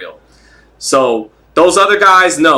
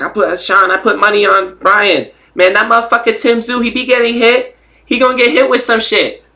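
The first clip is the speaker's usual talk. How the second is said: high-pitched hyped boxer impression; Rolly; 8 semitones higher than usual. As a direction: imitate a fighter's excited, higher-voiced trash talk. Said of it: "the Rolly impersonation is A one".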